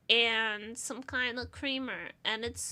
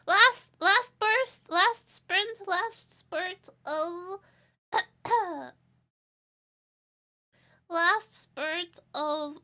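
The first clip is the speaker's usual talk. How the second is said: dramatic groan; 5 semitones higher, 9 dB louder, much slower than usual. Pretend. Dramatic exhausted exclaiming.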